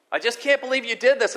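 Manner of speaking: high, loud angry scolding; ranting and raving. Retold or imitated. Father scolding his hurt son, loud angry rant. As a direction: let the boy have it. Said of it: "ranting and raving at him".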